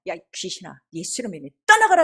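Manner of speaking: low start bursting into a loud command; exorcism command. Retold or imitated. 귀신을 쫓는 명령 재연. (귀신 쫓는 호령 재연)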